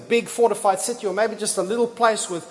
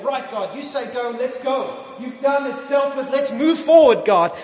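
eager trust; 5 semitones higher, 4 dB louder than usual. Retold or imitated. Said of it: "Faith is Joshua going".

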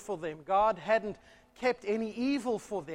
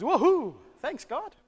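Adam's delight; 7 semitones higher, 4 dB louder than usual. Retold or imitated. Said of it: "Adam turns around and says".

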